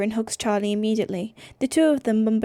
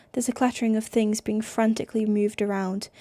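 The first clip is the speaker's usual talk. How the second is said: ordinary delivery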